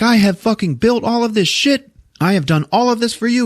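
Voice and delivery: high-pitched, indignant mock complaint; acted indignation. Wife fighting with husband over the house, indignant. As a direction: throw it at him in a high voice.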